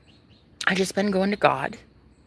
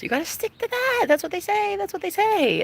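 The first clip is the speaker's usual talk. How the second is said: mocking advice; 11 semitones higher than usual. Mocking what society tells women.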